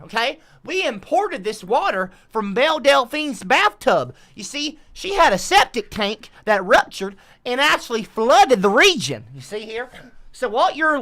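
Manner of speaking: folksy swamp tour guide. Acted. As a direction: play a chatty bayou guide selling the sights. Plays a swamp tour guide pitching tourists.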